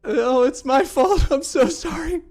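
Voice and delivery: slow, high, loud wail of guilt; tearful apology. Acted. Kadal tearfully takes the blame.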